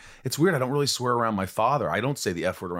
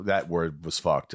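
normal talking voice

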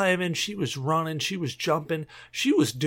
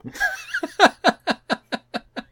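high squealing laugh. Real, not acted. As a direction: giggle up high. After spank bank confession.

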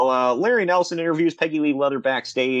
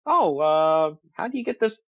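bank teller; 9 semitones higher than usual. Retold or imitated. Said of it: "they were like".

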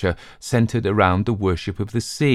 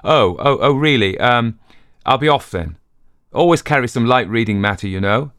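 flustered, higher and louder; startled; 4 semitones higher, 5 dB louder than usual. Acted. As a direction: caught out, cover quickly. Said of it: "with a start".

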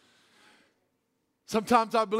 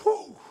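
high excited exhale after yelling; excited whew; 11 semitones higher than usual. Real, not acted. Preacher catching breath after shouting. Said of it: "Why am I yelling?".

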